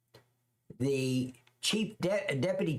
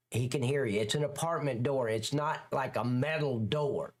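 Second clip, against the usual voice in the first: ordinary talk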